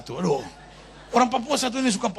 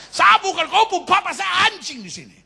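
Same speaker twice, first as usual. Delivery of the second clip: put-on higher, louder drunk father yells in fury; drunken angry shout; 13 semitones higher, 5 dB louder than usual. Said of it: "dia so marah sekali".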